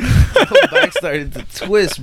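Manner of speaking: can't stop laughing while telling it; laughing through it. Real, not acted. Laughing while retelling the pain.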